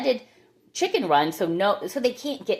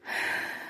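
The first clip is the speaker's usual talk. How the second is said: breathless sigh; 9 dB quieter than usual. Real, not acted. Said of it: "Sorry, I'm out of breath".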